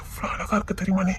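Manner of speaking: soft intimate whisper. Real, not acted. Man whispers a compliment to his colleague. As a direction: whisper it close to her ear.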